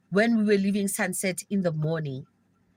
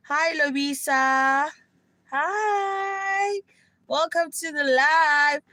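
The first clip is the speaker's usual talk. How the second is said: higher, cheery hello to a viewer; bright greeting; 6 semitones higher, much slower than usual. Real, not acted. Greeting Lobisa who joined the live, cheerfully. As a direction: lift up brightly to welcome her in.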